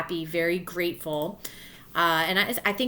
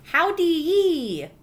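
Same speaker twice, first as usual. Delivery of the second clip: put-on high, slow, drawn-out baby babble; 8 semitones higher, 5 dB louder, much slower than usual. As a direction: coo it like a six-month-old. Baby Helen's first words voiced.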